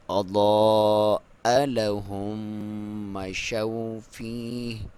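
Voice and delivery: slow drawn-out tajweed chant; Quran recitation. Shown as a demonstration. Teacher recites the verse for students, slow chant. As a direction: recite it slowly with full tajweed. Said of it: "ناک میں سے آواز نکالیں گے".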